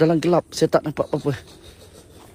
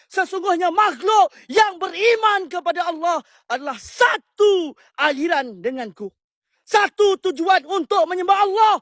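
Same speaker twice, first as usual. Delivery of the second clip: raised, high shouted invocation, voice cracking near tears; shouting; 9 semitones higher than usual. Real, not acted. Ghost hunter shouting a challenge at the jinn in the dark, tearful shout. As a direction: call out to the spirit at full force, close to crying. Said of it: "suara jerit-jerit".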